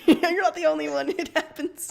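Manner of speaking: laughing, high and loud; real giggling reassurance. Real, not acted. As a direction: giggle through the words. Laughing out of character.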